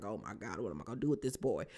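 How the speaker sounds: a soft, rushed, weary lament; mock despair. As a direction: mutter it under your breath, fed up. Rock despairing over her son.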